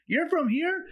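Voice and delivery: high, astonished question; can't believe it, pitch leaping; surprised. Retold or imitated. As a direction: hiker hearing the climber is from Peru, amazed and high. Hiker learns he's Peruvian.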